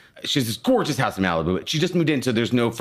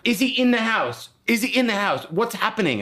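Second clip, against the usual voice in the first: much higher, urgent mock cop voice; police asking about the intruder, much higher; imitating frantic police; 10 semitones higher than usual. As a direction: ham up the officers searching the house. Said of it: "And they're like".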